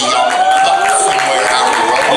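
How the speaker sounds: shouting. Real, not acted. Calling out sign-off to fans.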